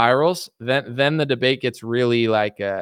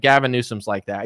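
ordinary delivery

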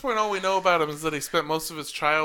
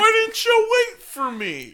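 high whiny complaint; 7 dB louder than usual. Pretend. Acted whine at being left behind.

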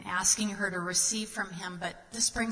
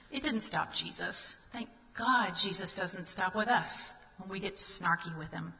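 ordinary talk, nothing marked

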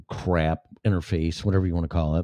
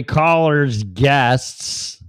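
hyped announcer; 5 semitones higher, 6 dB louder, much slower than usual. Acted. Hyped announcer roll call.

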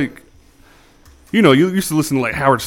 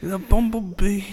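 higher, slow British documentary voice; British narrator accent; 7 semitones higher, 4 dB quieter, slower than usual. Acted. Copying a friend's wildlife narrator, slow British accent. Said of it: "this British dude, like, describing".

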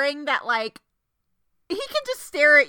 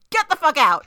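louder, punchy dismissal; emphatic rejection; 5 dB louder than usual. Acted. Fan answering Camilla's love confession, emphatic rejection. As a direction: order her out in mock outrage.

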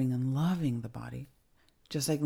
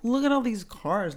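a raised, louder gush of amazement; impressed friend; 7 semitones higher, 6 dB louder than usual. Retold or imitated. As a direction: sell it like the cars prove everything. Friend gushing about surgeon's fancy cars.

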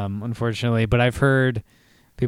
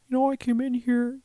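mild annoyance, pitched up and hushed; imitating a timid, upset Owen Wilson; pissed-off Owen Wilson; 11 semitones higher than usual. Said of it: "Owen Wilson's always kinda timid".

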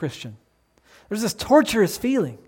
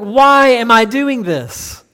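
slow, drawn-out, louder mock groan of regret; silly exasperation; 6 dB louder, much slower than usual. Preacher mocking regret after too much ice cream, silly groan. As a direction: whine it like a guilty spoonful of ice cream. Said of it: "We say that in a silly way".

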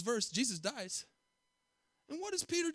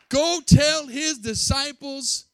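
slow, high, loud proclamation; emphatic scripture; 7 semitones higher, 11 dB louder, much slower than usual. Acted. Preacher reading the angel's charge, slow and loud. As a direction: proclaim the command.

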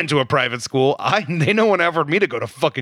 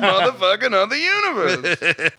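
hammed-up fed-up rant, higher-pitched; mock exasperation; 6 semitones higher than usual. Co-host parodies the actor's fed-up line, mock exasperation. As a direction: finish the parody rant with a laugh in it. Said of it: "Always fatigued".

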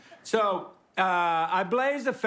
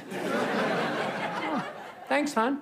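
dry, laughing "thanks, hon"; mock gratitude, a laugh under it; sarcastic thanks; 6 semitones higher, much slower than usual. Real, not acted. To his wife, a mock-grateful laughing thanks.

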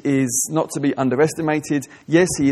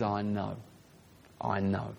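soft and much quieter, tender comfort; gentle reassurance; 8 semitones lower, 15 dB quieter, slower than usual. Acted. Jesus consoling a struggling believer, softly tender. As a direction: hush it like comforting a grieving friend.

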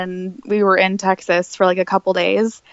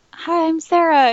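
higher-pitched, lightly voiced eager girl; lightly mimicked chirpy hello; imitated girl's introduction; 7 semitones higher than usual. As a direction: introduce yourself as a fan, pitched up. Voices girl meeting a celebrity.

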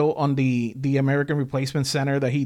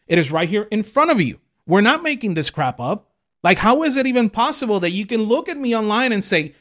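usual speaking voice

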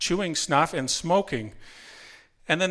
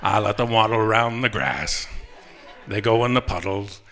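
Morgan Freeman; 5 semitones lower than usual. Acted. Customer toying with a telemarketer about ducks, Morgan Freeman impression. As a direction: draw it out low and unhurried, with pauses. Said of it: "maybe Morgan Freeman or something like that".

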